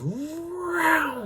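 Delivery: high, far-off whooshing truck sound; imitated truck whoosh. Storyteller mimics a truck speeding past camp, high whoosh. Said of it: "it goes".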